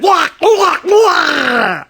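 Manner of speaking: high, loud yelps; cartoon shrieks ending in a long wail; comic cry of alarm. A narrator's reading. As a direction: holler like you've been pounced on. Mother cries out when jumped on.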